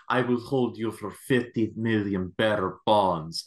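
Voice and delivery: mock Russian villain accent; hammed-up Russian-accented movie villain; bad Russian accent. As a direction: put on a heavy, unconvincing Russian accent. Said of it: "tries to pull off the Russian accent".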